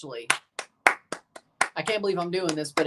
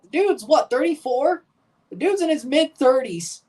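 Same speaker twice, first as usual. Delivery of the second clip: incredulous; 7 semitones higher, 3 dB louder than usual. Real, not acted. Mocking an old keeper.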